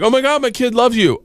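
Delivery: high, excited gush imitating fans; excited imitation of recognizing a celebrity. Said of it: "they're like".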